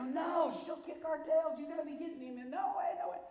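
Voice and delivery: high, strained frightened pleading; terrified helpers backing away; panicked refusal. Retold or imitated. Men refuse, scared of her.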